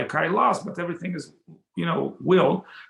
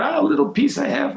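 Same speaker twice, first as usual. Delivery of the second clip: excited offer; 10 semitones higher, 4 dB louder, faster than usual. Acted. Shiva eagerly offers leftover prasad.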